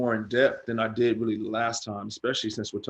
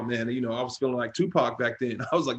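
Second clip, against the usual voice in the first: normal talking voice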